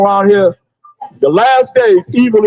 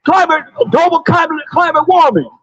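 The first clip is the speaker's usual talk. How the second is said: high mock panic cry; mock scream; 7 semitones higher than usual. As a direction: stammer it out like a frightened victim. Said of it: "What they be screaming?".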